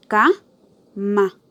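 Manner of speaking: CA... ma, syllable by syllable; cama split, stress on CA. Shown as a demonstration. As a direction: separate cama's syllables and punch CA. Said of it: "que tiene más intensidad".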